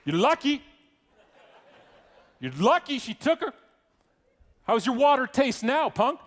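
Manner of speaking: high, loud, cocky jeering; mock taunting shout. Usher jeering at the kid from behind coworker, mock taunting shout. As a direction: yell taunts from safely behind a protector.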